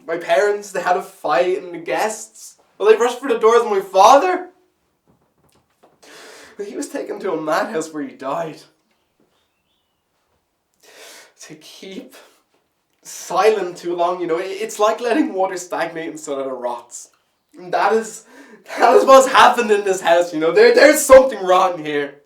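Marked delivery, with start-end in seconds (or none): 0.1-4.5 loud, raised voice building in urgency; agitated recounting. Acted. Recounting a traumatic family scene. 13.0-22.2 loud, high voice building to an outcry; impassioned accusation. Acted.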